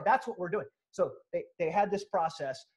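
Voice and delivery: usual speaking voice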